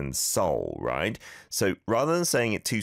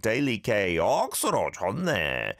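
Busan accent; 2 semitones higher, faster than usual. Shown as a demonstration. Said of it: "You could change Busan for anything".

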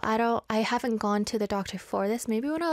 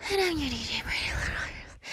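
whispered intro; 3 dB quieter, faster than usual. Acted. Radio host intro, whispered.

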